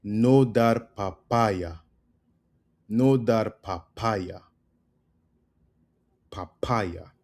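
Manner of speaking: "no dar papaya" said slowly, each word separate; slow teaching. Shown as a demonstration. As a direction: spell it out slowly for learners. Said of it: "And now we have".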